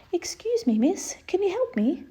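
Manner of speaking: a tiny princess asking for help; high little princess voice. Acted. Princess at the foot of the bed, high and polite. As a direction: lift it up high, small and polite.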